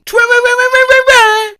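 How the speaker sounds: very high, loud warble; high warbling noise. Retold or imitated. Performing the written noise for viewers, high warble. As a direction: trill it like a siren. Reading transcribed noise as sound.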